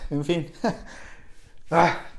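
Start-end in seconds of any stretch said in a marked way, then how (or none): 0.6-1.7 quiet laugh. Real, not acted. Laughing after coughing fit.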